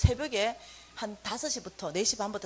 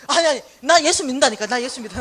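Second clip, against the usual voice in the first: loud giving in; 12 dB louder than usual. Retold or imitated. Roommate loudly surrendering to the mother's nagging. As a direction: shout that she believes now.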